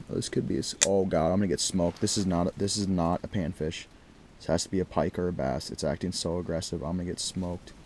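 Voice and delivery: soft, low, hushed running commentary; hushed suspense. Real, not acted. Ice angler watching a big mark approach, hushed. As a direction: keep it low, eyes on the fish.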